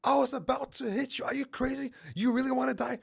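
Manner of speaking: very high, loud, alarmed yelling; freaking out. Retold or imitated. Attacker to his fearless victim, high-pitched freaking out. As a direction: imitate a panicked man, voice shot way up. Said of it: "freaking out".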